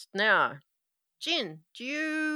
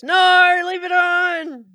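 excited shout; 9 semitones higher, 13 dB louder, slower than usual. Acted. GM voices eager texter shouting.

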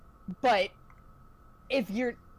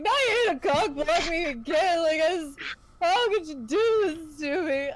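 higher, louder crying plea; drunk sobbing; 6 semitones higher, 3 dB louder than usual. Retold or imitated. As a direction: wail through drunken sobs. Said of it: "He starts just sobbing".

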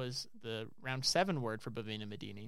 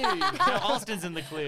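laughing; 6 semitones higher, 7 dB louder, slower than usual. Real, not acted. Laughing while teasing.

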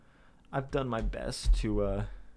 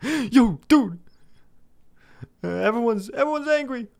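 much higher, much louder frantic yell; panicked inner yell; 14 semitones higher, 8 dB louder than usual. Retold or imitated. His panicking brain shouting to escape, frantic yell. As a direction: scream it like your brain is fleeing. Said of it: "my brain isn't letting me".

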